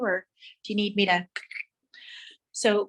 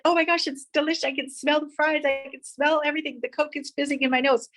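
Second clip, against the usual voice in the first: much higher, fast delighted gushing; relishing fries and fizzy Coke, much higher, thrilled; thrilled food delight; 7 semitones higher than usual. Pretend. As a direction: savour it like the best meal ever. Imagining eating a hamburger.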